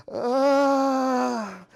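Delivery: high, loud mock cry of disgust; horrified cry. Comic recoiling from a stranger's wide yawn, mock cry.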